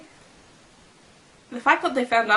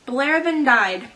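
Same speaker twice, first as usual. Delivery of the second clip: mock dramatic reading; 2 semitones higher, 7 dB louder, slower than usual. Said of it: "Nah, I'm joking".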